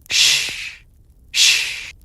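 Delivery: breathy unvoiced shh of a shovel cutting earth; shovel scrape imitated. Narrator imitating the shovel in the cemetery, soft unvoiced shh. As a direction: hiss out the spade biting wet grass.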